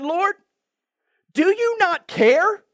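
high, loud, drawn-out complaint; hammed-up indignant appeal to the Lord; fed-up Martha. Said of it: "Martha, now she's had enough".

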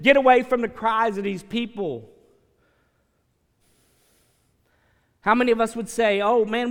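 loud, driving preacher's voice; full-force preaching. Real, not acted. Preacher urging church to save the drowning, full force. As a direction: hammer it home to the pews.

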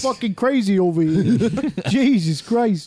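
hammed-up New York-New Jersey accent; mock a movie's out-of-place New Jersey accent; cartoonish New York accent, breaking into laughter. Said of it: "out of place New York, New Jersey accents".

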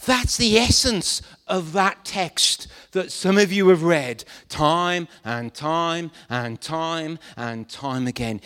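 ordinary talk

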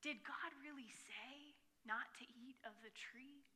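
hushed tempting whisper; Satan's whisper. Retold or imitated. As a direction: whisper it like the serpent luring. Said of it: "Satan himself is the one who said".

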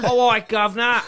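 high, loud mock Cockney; Cockney accent. Comic echoing a friend's accent, loud Cockney reply. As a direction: holler back in exaggerated Cockney. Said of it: "they do the Cockney".

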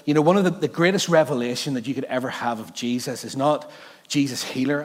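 ordinary talk, nothing marked

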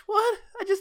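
very high-pitched what; acted bewilderment; mock startled squeak. Acted startled little girl.

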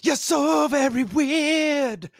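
high, slow hammed-up announcer; mock announcer. Mock announcement of weird corner.

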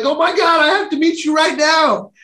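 high, urgent burst of eagerness; excited outburst. Retold or imitated. Speaker to long-lost sister on the phone, excited.

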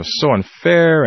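retold railing. Child protesting the broken headset, indignant railing. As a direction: drag out an indignant childish complaint. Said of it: "start railing".

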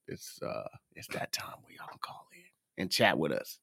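hoarse, half-voiced with a cough coming. Real, not acted. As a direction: rasp it out through a tickle in the throat. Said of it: "excuse me if you guys hear me coughing".

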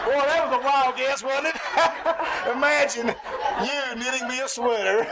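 high, laughing teasing; husband laughing, teasing his wife; teasing laughter. Acted. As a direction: chortle at your own joke.

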